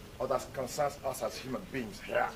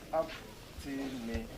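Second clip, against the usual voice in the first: optimism slow and softer, first syllable stressed; slow demo word; 6 dB quieter, much slower than usual. Shown as a demonstration. Teacher modelling before students repeat, slow. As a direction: say optimism slowly for them to copy. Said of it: "so please say optimism".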